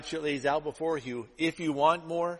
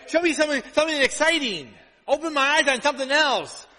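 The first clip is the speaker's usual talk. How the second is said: high, loud, pleading imitation; mock eager demand; 11 semitones higher, 7 dB louder than usual. Believer pleading with God for something new, mock eagerness. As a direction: beg for novelty, hammed up. Acting out people craving novelty.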